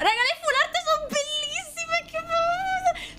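high delighted squeal; gushing. Real, not acted. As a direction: coo over the cards in a high voice. Gushing over full arts.

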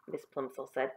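plain reading voice

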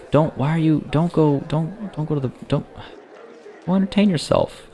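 high, pleading plea to a sim student; high desperate pleading at a quitting student. Real, not acted. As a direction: beg them not to go, pitch way up. Begging a student not to leave.

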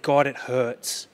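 slow, pained plea, drawn out; slow broken lament. Retold or imitated. Grieving father telling God it hurts, slow and broken. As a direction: let the words ache slowly.

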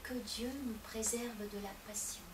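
soft, low murmured prayer; a child murmuring a prayer alone in a corner; hushed child's prayer. Acted. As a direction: pray under your breath like a small boy. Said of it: "comme à son habitude".

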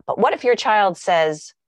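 ordinary talk, nothing marked

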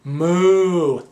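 a loud, hammed-up moo; cow moo. Man reading a farm picture book, mooing loudly.

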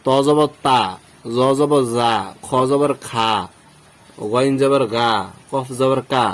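তা, জা, খা, গা, কা all with long open "aa"; wrong thin vowels. Shown as a demonstration. Teacher listing common mistakes, open "aa" in তা, জা, খা, গা, কা. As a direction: recite each letter ending in thin "aa": তা, জা, খা, গা, কা. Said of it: "ভুল উচ্চারণ".

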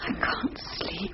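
slow, breathy whisper in the dark. Acted. Wife whispering to husband in bed, whispered. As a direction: tell him softly so no one else hears.

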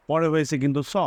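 ordinary talk

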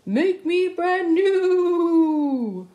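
high, loud and very slow, stretched out; slow eerie puddle voice. Acted. The puddle voice calls to the children, slow and eerie. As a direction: drawl it out like a ghostly voice from a puddle. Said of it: "A voice from the puddle said".

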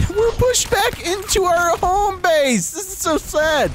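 laughing. Real, not acted. Gamer laughing at being pushed back, high mock wail. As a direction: laugh through a playful wail.

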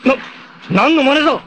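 high, startled cry at the foe; shocked shout. Acted. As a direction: yell in alarmed disbelief. Shock at enemy's trick.

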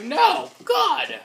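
high shouted denial; loud protest. Pretend. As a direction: yell it in mock outrage. Exaggerated denial.

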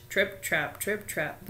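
lightly voiced heavy goat steps; big hoofsteps. A narrator's reading. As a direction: plod the words out like big hooves. Biggest goat's hooves on bridge.

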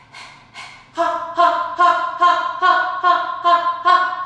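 loud rhythmic panting on ha; panting like a dog. Shown as a demonstration. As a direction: pant hard like a dog. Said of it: "So pant like a dog".

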